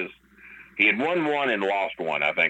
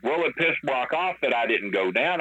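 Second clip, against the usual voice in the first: ordinary delivery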